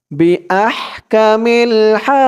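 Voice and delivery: final হা held long in a slow chant; one-alif stretch. Shown as a demonstration. Teacher showing the stretch for learners, হা chanted long. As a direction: draw out হা at the end. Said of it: "খারা জবর এক আলিফ টান".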